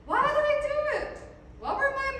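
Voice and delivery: high, rhetorical questions; imagined questioner. Acted. Defendant mimicking what the jury might ask, high and rhetorical. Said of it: "You might ask".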